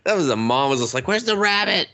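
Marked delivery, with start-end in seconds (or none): 1.1-1.9 high, whiny mock mom; a hint of a confused mom. Friend recapping the show, mocking the mom, high and whiny.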